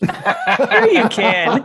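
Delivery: slow, laughing reassurance. Real, not acted. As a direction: laugh while saying it. Teasing reassurance through laughter.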